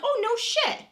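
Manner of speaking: high, loud sarcastic "oh, no shit"; sarcastic retort. Retold or imitated. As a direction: fire it back with mock disbelief. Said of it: "I'm like".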